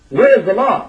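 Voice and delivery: repeated loudly, pushing hard; loud insistent demand. Retold or imitated. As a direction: insist loudly on "Where is the law?". Brother repeats his question louder.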